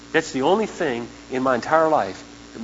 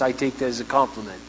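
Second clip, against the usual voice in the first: ordinary talk, nothing marked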